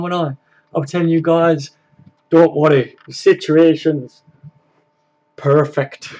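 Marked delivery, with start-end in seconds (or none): 2.3-6.1 put-on a light, half-hearted Northern Irish manager accent; mock the calm Northern Irish reassurance, badly done; imitating Rodgers. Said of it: "I can't do his fucking, his accent at all".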